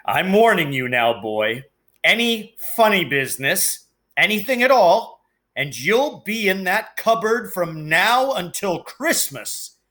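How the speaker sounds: gruff, menacing uncle leaning in close; Uncle Vernon threatening. Acted. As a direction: warn the boy like a bullying uncle.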